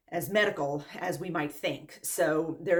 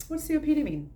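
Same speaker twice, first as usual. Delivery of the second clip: mock innocent question; 8 semitones higher than usual. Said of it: "I'm like".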